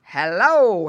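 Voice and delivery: loud, startled hello; exclaiming. A narrator's reading. Farmer counts the pigs, exclaiming. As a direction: exclaim it loudly on a sudden discovery. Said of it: "he exclaimed".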